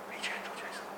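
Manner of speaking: whispered aside; a real whisper to Jason. Real, not acted. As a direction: whisper it off-mic. Aside to a person nearby.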